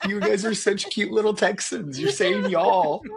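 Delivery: put-on high-pitched, amused parent gushing over the kids; fond cooing. Fond parent reacting to his kids.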